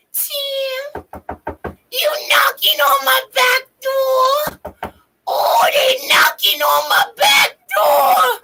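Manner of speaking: very high, stretched cartoon wail; Lois. Cartoon wife calling her husband by name, high wail. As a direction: wail it slowly in a put-on voice. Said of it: "They had a picture of Lois".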